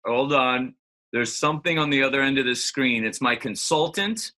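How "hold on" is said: ordinary talk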